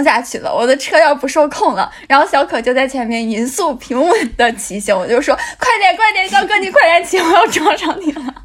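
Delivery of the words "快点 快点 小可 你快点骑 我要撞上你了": high, loud, laughing panic yell; panicked shout. Retold or imitated. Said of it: "我当时喊的时候嗓门特别大".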